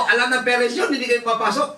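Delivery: high, rising reproach; scolding. Retold or imitated. Pastor scolding truant students, high and sharp. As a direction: press them with pointed questions.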